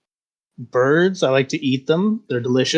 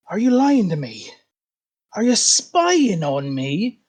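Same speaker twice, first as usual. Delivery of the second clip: high, sharp accusation; suspicious interrogation; 5 semitones higher than usual. Acted. Stump creature accusing the siblings, higher and suspicious. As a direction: jab the question like a poker.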